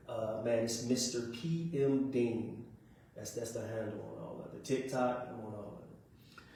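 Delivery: ordinary delivery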